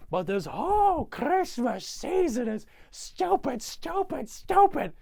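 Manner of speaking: high, drawn-out theatrical yelling; mock outraged rant. Grinch denouncing Christmas, high hammed-up outrage. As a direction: build to a high, exasperated holler.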